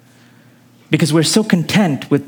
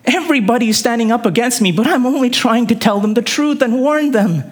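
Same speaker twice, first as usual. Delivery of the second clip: raised, urgent plea; higher-pitched acted plea, pressing on to the end; pleading; 6 semitones higher than usual. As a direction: plead to God as a prophet standing alone. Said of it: "he's gonna plead and say".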